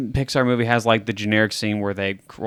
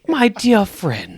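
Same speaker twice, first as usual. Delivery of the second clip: posh gentleman; 8 semitones higher, much slower than usual. Pretend. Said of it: "esteemed gentleman".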